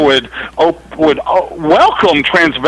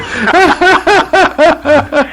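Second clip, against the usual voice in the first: laughing fit; 10 semitones higher than usual. Real, not acted. Caller cracks up at joke.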